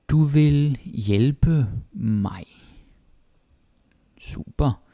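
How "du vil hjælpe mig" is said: Du vil hjælpe mig drawn out slowly and higher; very slow, higher model. Shown as a demonstration. Teacher slowly modelling a sentence, higher. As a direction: stretch every word for learners.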